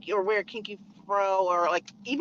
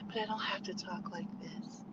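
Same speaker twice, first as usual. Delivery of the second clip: much softer, small and breathy; soft breathy demure voice; 4 semitones lower, 12 dB quieter than usual. Shown as a demonstration. Mocking the demure trend for women, in a soft breathy voice. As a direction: shrink the voice to a soft breathy murmur.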